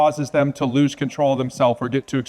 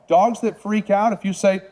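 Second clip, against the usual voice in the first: ordinary delivery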